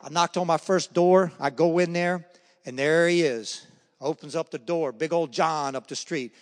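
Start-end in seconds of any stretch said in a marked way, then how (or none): none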